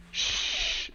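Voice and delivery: sharp drawn-out shush. Acted. Lady Saveshna shushes him, laughing shush. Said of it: "Lady Saveshna just goes".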